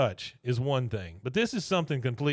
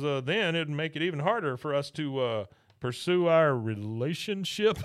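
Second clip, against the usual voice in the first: dragging the words out; 3 semitones higher than usual. Retold or imitated. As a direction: stretch every word like you're squirming. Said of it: "dragging these words out".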